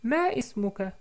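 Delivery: put-on Ma ismuka asked high as the girl; teacher repeating Sarah's question, high; girl's voice. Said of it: "Donc je répète".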